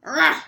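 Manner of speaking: loud short rah; bear roar. Acted. Bear grumbling at the old lady, loud rah. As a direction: bark it like a bear. Bear's noise in story.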